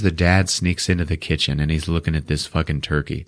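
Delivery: ordinary talk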